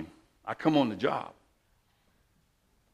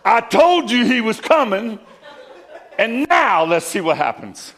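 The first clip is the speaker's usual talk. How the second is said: loud and higher, half laughing, crowing in triumph; retold scream; 12 semitones higher, 11 dB louder, slower than usual. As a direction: shout it out like the brother taunting the gunman. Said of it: "he's screaming".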